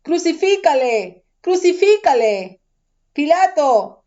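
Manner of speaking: crowd crying out. A narrator's reading. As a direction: call out for the crucifixion as the crowd. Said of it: "dieron voces diciendo".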